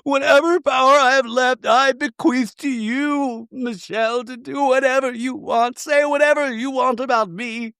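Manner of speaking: high-pitched, slow, weepy imitation; mock crying. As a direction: wail it wearily, hamming up the tears. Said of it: "He can cry on demand".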